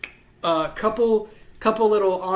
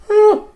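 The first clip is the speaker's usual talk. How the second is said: big high yawning groan; loud yawn; 14 dB louder than usual. Real, not acted. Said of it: "keep yawning".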